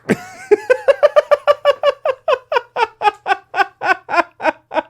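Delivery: high, loud helpless laugh; real laughter. Real, not acted. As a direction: burst out laughing. Reader cracks up.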